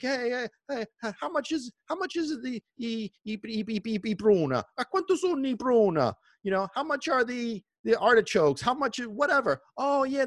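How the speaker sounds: high voice calling down from a terrace; woman on the terrace calling her order to the vendor; old lady ordering. Retold or imitated. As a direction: haggle like a neighborhood grandma.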